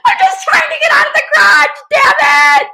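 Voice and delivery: loud, high, frantic yelling; shrill-pitched, fed-up outburst; exasperated shouting. Retold or imitated. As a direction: shout in a mock meltdown at the car. Acts her meltdown at the car.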